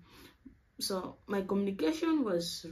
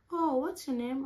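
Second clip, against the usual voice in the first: lightly voiced girl asking, pitched higher; friendly curious question; 7 semitones higher than usual. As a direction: ask like an eager new friend. Shweta asks her name.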